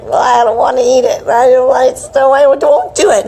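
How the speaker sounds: put-on childish refusal. Refusing the drink her family pushes, mock stubborn. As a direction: dig in like a stubborn kid.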